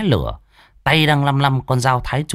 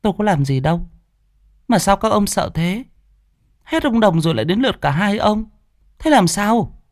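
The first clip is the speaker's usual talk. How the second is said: higher, bewildered woman's voice; puzzled woman; 10 semitones higher than usual. Acted. Wife puzzled why the men fear her, higher. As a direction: ask in honest confusion.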